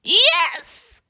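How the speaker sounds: very high witch cry; a high squeaky witch yes; witch cries yes. A narrator's reading. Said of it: "cried the witch".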